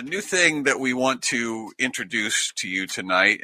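ordinary talk

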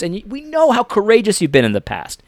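ordinary talk, nothing marked

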